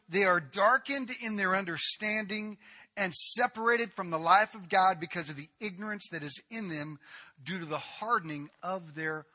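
ordinary talk

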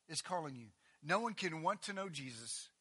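usual speaking voice

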